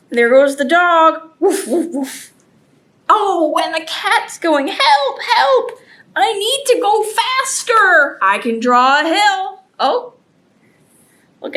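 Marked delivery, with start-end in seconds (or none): 1.4-2.3 breathy pretend woofs; quick run of mock barks; dog barking. Imitates the chasing dog. 4.7-8.2 high-pitched mock cries for help; playful panic, pitched way up; scared cat. Scared cat flees the dog.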